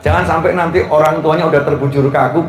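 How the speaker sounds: ordinary talk, nothing marked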